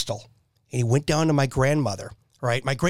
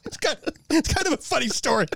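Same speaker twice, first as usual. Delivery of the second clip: words through giggles, higher; laughing while talking; 6 semitones higher than usual. Real, not acted. Telling his friend the story, still laughing.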